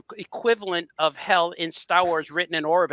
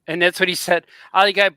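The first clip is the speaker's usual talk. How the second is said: normal talking voice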